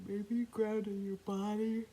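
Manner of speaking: high, loud, drawn-out yawned words; yawning. Real, not acted. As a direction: talk through a big yawn. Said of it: "Maybe you ground in a yawn".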